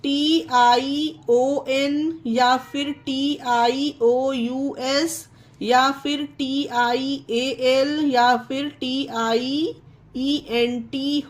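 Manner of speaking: letters spelled slowly, rising on each group; slow spelling out. Shown as a demonstration. Teacher spelling endings for students, slowly. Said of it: "कि जब किसी word के अंत में".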